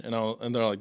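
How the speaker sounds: usual speaking voice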